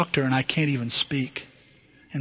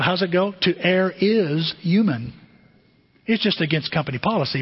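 ordinary talk, nothing marked

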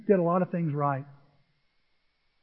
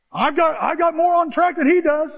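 louder, higher smug churchgoer voice; pitched-up smug boast; mocking self-righteous boast; 9 semitones higher, 5 dB louder than usual. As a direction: brag like a smug church member. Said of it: "self-righteous voice answered by Yeah?".

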